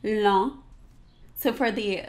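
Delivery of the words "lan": lan with dark nasal ah; French nasal lan. Shown as a demonstration. Teacher demonstrating the A O N spelling, nasal. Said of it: "which would be lan".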